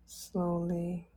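ordinary delivery

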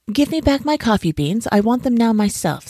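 high, insistent demand; mildly snarling monkey; grouchy snarl. A narrator's reading. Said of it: "snarling grouchily".

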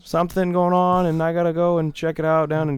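high, stalling made-up excuse; half-hearted squeaky excuse; mock flustered excuse. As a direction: stay up high and invent a reason. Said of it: "I was like".

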